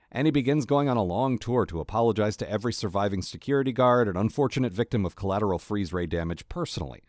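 ordinary talk

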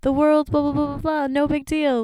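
high breezy dismissive voice; carefree parents. Retold or imitated. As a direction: brush it all away.

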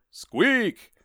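high squeaky rat noise; tiny startled squeak. Acted. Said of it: "said Maurice".

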